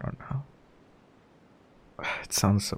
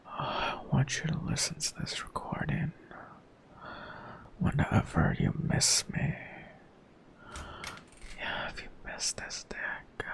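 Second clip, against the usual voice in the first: right in your ear; 7 semitones higher, 9 dB quieter than usual. Acted. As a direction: close whispered voice right at the ear. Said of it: "whispering in your ear".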